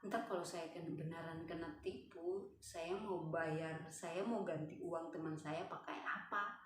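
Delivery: soft, subdued worrying aloud; worried thinking. Retold or imitated. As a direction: fret quietly to yourself. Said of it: "Cuma pikirnya".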